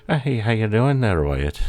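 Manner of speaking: a stage-Irish neighbour saying hello; mock Irish accent. Mocking Irish people greeting Wyatt in a hammed-up Irish accent. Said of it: "Sounds like everybody ... been Irish".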